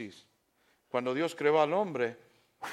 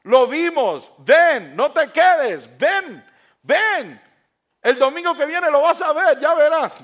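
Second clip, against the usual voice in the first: louder, high, insistent urging; urgent pleading call; 12 semitones higher, 15 dB louder, slower than usual. Retold or imitated. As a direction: call a friend back, begging him to come. Disciples urging Thomas to come.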